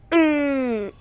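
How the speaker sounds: ordinary talk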